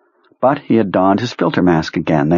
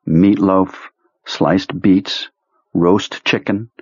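ordinary delivery, nothing marked

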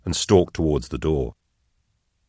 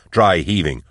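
plain reading voice

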